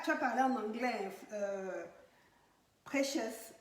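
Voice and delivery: normal talking voice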